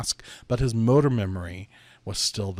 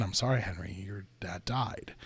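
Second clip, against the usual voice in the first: gentle bad news; 3 semitones lower, 7 dB quieter than usual. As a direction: ease the blow softly, almost whispering. Nurse breaking sad news.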